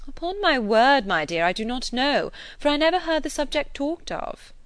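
lightly voiced airy lady, rising high; a touch of affected surprise; Mrs Elton. A narrator's reading. Mrs Elton brushing off Jane's question, lightly voiced.

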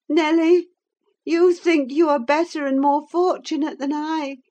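put-on high, yearning young woman voice; feverish young woman. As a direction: plead it like a dying heroine. Catherine voiced in read novel.

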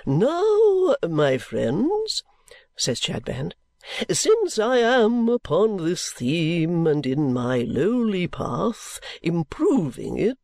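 high, slow, unctuous sermonising; oily preacher voice, drawn out; pompous preacher. Acted. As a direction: play the greasy self-satisfied preacher. Chadband sermon.

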